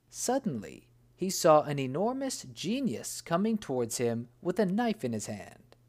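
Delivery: even, measured storytelling voice; calm fireside storyteller; story narration. A narrator's reading. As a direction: tell it like a tale read aloud.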